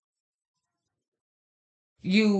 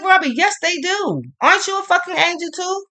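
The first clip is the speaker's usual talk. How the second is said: quoting herself in a much higher, fast, indignant voice; mock outrage, pitched way up and rushed; high indignant protest; 11 semitones higher than usual. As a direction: squeal it back at him, offended and playful. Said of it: "And then I'm like".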